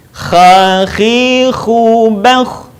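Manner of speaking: "Kha, khi, khu, bakh" in a raspy long-vowelled chant; swung syllables. Shown as a demonstration. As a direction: swing through "Kha, khi, khu, bakh", rasping each kh. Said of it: "gabungkan dengan ayu-ayun". ("Kha, khi, khu, bakh" diayun bersambung)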